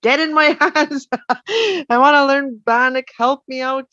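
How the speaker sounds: playful prayer with a laugh; invoking ancestors. Retold or imitated. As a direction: call out to the ancestors, half-joking. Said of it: "held up my hands and said".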